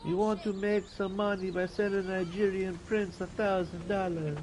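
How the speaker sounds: put-on high-pitched jokey Nigerian accent, held on every word; a self-mocking Nigerian prince voice. Streamer admits he can't do it, still in a mock Nigerian accent. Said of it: "I can't do a Nigerian accent".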